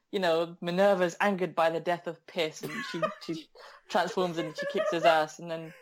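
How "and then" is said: ordinary talk, nothing marked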